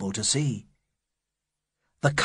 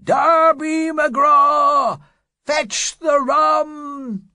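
much higher, louder, slow drawn-out scream; eerie slow high shriek; ghostly scream; 6 dB louder, much slower than usual. Acted. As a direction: wail the name like a ghost calling. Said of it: "it screamed".

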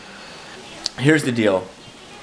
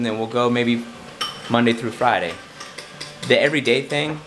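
ordinary talk, nothing marked

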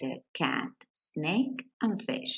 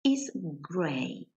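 1 slow reading aloud; much slower than usual. Shown as a demonstration.

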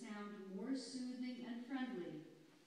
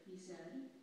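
ordinary delivery, nothing marked

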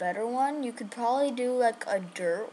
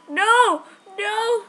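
1 alarmed no; 8 semitones higher, 6 dB louder than usual. Real, not acted. Getting killed in game.

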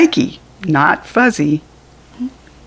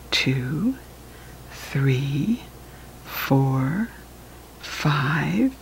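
much softer hushed whisper counting; 4 semitones lower, 11 dB quieter, much slower than usual. Acted. Parent counting butterflies with the child, softer hushed whisper. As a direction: count in a hush so the butterflies stay.

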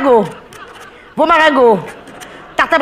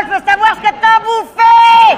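higher, louder, slow exasperated shout; exasperated yell; 8 semitones higher, 3 dB louder than usual. Acted. Waiter yelling at a crying diner, exasperated. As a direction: yell it while swearing you aren't angry. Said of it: "je t'agresse pas".

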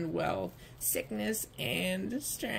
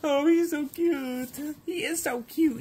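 high, emotional cooing over a newborn; gushing new mother; 9 semitones higher, 3 dB louder than usual. Acted. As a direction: gush adoringly in a raised voice.

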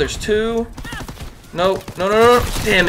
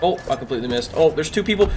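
ordinary talk, nothing marked

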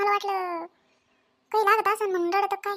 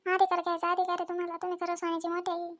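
remorseful apology; 6 dB quieter than usual. Acted. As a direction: quietly beg forgiveness, ashamed. Continued tearful apology. (आई पश्चात्तापाने हळू आवाजात माफी मागते)

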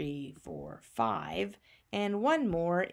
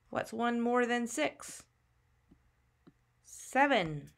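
ordinary talk, nothing marked

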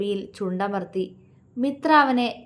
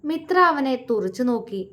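ordinary delivery

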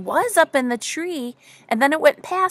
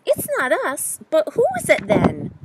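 higher-pitched questioning bear; puzzled bear; 6 semitones higher, 5 dB louder than usual. A narrator's reading. Bear asking the friends, high and puzzled. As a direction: lift it high and press the question. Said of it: "Bear says".

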